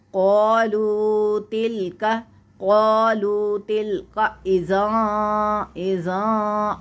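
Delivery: slow, drawn-out Quranic recitation. Shown as a demonstration. Teacher recites the verse slowly for the student. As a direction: chant each syllable long and measured.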